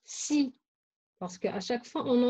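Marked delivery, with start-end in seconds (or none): 0.0-0.5 si said alone as "see", with a crisp s; sound si. Shown as a demonstration. Teacher naming the sound for pupils, si pronounced "see". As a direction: isolate si as one clear syllable. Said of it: "Le son si".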